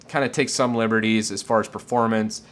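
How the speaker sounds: ordinary talk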